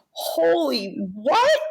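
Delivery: high, slow, drawn-out gasp of disbelief; stunned disbelief. Retold or imitated. Hearing about the Oscar nomination on the phone, stunned and disbelieving. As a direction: blurt it out, floored by the news. Retold shock at Oscar news.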